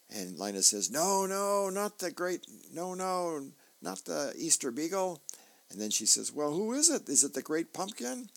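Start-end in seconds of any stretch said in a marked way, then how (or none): 0.9-5.2 put-on Linus's kid voice. Linus correcting Lucy. 6.3-8.3 put-on Lucy's kid voice. Lucy asking who comes.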